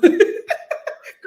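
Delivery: unvoiced airy laugh at yourself; breathy laugh. Real, not acted. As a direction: laugh off the blunder. Laughs at own mistake.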